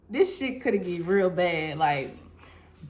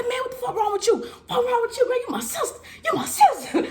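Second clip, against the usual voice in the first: friends yelling; 13 semitones higher than usual. Retold or imitated. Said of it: "They're like".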